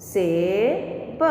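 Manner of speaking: सेब drawn out very slowly; teacher slowly saying the blended word सेब; slow demo word. Shown as a demonstration. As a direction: stretch सेब for the class to copy. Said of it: "आया समझ में बच्चों".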